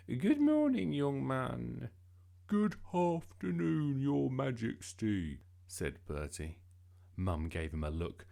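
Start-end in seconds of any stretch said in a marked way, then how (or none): 0.1-1.9 high, loud regal greeting; mum playing the Queen. A narrator's reading. Mum pretending to be the Queen for practice, regally. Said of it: "Pretend I'm the Queen". 2.5-5.4 slow, high, loud, as if chewing gum; affected polite voice. A narrator's reading. Bertie practising on Mum as Queen, absurdly polite and slow. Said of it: "got a mouthful of chewing gum".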